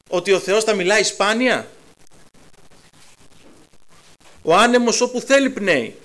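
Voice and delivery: loud, driving sermon voice, pushing each question; fiery preaching. Real, not acted. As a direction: preach it out full force to the congregation. Preacher at full force.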